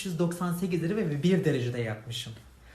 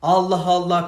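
loud, higher exasperated outburst; indignant shouting rant; angry rant; 6 semitones higher, 9 dB louder than usual. Real, not acted. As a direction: blow up at them, loud and high. Furious rant at the authorities.

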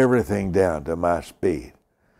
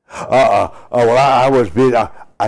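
loud, higher mock fluster; stammering excuse-maker; 4 semitones higher, 10 dB louder than usual. Preacher imitating someone caught ignoring his message, flustered and loud.

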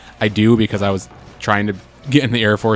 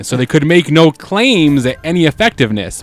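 ordinary delivery

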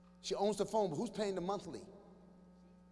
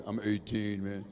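much lower, slow mock-tough teen; deep voice; 14 semitones lower, slower than usual. Teen boy answering the comic's age question in a deep voice. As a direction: drop the voice way down to sound grown. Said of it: "He had the deep voice".

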